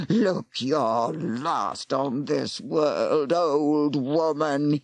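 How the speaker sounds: very slow, cold snarl. Acted. Warlock snarling at the woman he strangles. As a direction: spit out a death sentence. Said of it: "he snarled".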